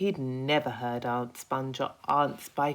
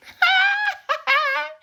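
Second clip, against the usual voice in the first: high, loud bug guffaw; roaring laugh; 12 dB louder than usual. Acted. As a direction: roar with laughter. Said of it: "Centipede roared with laughter".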